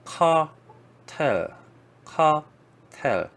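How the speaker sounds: 카텔 stretched very slowly, English r dropped; 카텔 drawn out slowly, KAR-tel in English; English pronunciation. Shown as a demonstration. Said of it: "영어로는 사실 카텔이라고". (영어식 카텔 아주 천천히)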